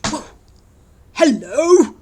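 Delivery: loud, high wolf greeting; growling wolf. Acted. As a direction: boom it out like a big bad wolf. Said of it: "growled the wolf".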